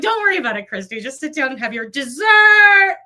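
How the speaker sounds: mock insistence. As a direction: shout it like family herding her to the table. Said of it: "everyone's like".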